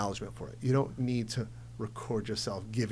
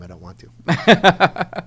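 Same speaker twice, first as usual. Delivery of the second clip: slow and loud, deadpan punchline; blunt joke; 5 semitones higher, 13 dB louder, much slower than usual. Real, not acted. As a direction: drag out the honest refusal, louder. Joking admission after setup.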